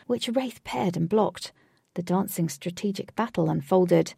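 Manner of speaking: ordinary delivery, nothing marked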